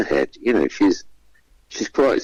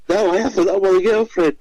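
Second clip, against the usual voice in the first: much higher, insistent denial; protesting contestant; 12 semitones higher than usual. Retold or imitated. Contestant denying he came for the host, high protest. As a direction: squeak out the denial.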